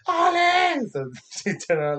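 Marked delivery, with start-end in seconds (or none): 0.1-0.9 frantic high scream, much louder; screaming a name. Retold or imitated. Uncle screaming for his niece in the park, frantic scream. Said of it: "I was screaming".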